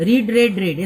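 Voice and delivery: রিড said as reed, then রেড twice as red; verb forms of read. Shown as a demonstration. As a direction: present রিড with a long ee, past forms রেড with a short eh. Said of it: "উচ্চারণ মানে প্রোনাউন্সিয়েশনটা change হয়ে যায়".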